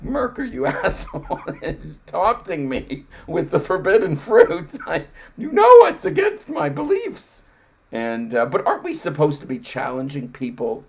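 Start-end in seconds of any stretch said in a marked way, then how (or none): 0.0-7.4 high, loud laughing mock outrage; a hammed-up furious believer cracking up. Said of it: "it's like".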